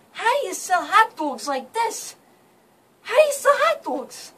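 loud, high, incredulous Jersey complaint; hammed-up New York indignation; acted outrage. Acted outraged thought about bad hot dog.

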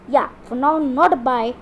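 ordinary talk

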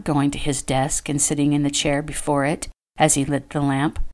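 ordinary delivery, nothing marked

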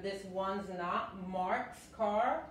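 slow, clear contraction demo; teacher reads slowly for learners; slow model sentence. Shown as a demonstration. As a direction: spell out "one's not" slowly. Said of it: "Se puede decir".

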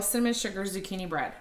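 ordinary delivery

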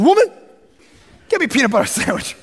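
hammed-up rude son, loud high woman, laughing; mock bossy son. Young guy mock-ordering his mom, hammed-up loud and rude. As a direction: boss mom around for a sandwich as a joke.

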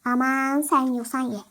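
usual speaking voice

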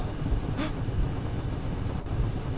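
soft, voiceless gasp; hushed gasp; frightened gasp. Real, not acted. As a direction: suck in breath in fright. Frightened in dark.

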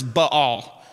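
Baal in two syllables, "buh-AHL"; correct pronunciation of Baal. Shown as a demonstration. Preacher showing congregation the correct pronunciation, Baal as buh-AHL. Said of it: "the correct pronunciation of that word".

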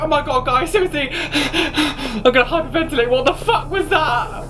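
high shrieking and laughing; hysterical panic. Real, not acted. Gamer to viewers after a fright, hysterical shriek. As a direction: lose it with fear and laughter. Terrified by monster.

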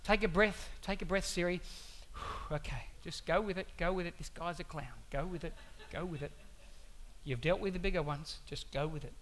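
very low, hushed self-talk; soft, exasperated pep talk to yourself; GPS calming itself. Acted. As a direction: mutter it under your breath to keep your cool. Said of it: "It's like".

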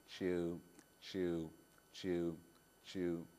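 low, slow chewing of a sheep. Acted. Boy showing the children what sheep do, low chewing. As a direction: chew it out like a dull sheep. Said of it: "Do you know what sheep do?".

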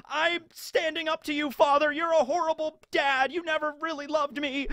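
high-pitched stand up to your father; Helmeppo defiant. Retold or imitated. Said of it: "he's just like".

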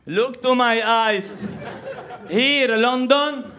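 very slow, high, laughing insistence; grandma's broken English. Retold or imitated. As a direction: spell it out slowly in broken English. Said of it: "قالت لها". (ستة تصرّ ببطء شديد بإنكليزية مكسرة)

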